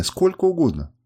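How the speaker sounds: urgent, imploring offer; pleading. A narrator's reading. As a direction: beg as a desperate captive. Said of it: "молила она, не слушая меня".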